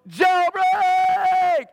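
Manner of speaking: full-voiced, high jubilant yell. Prisoner yells to the others as doors open, yelling. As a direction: holler it out, stretching the last syllable. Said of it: "and somebody yells".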